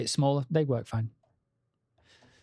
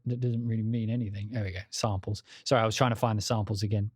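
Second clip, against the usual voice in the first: usual speaking voice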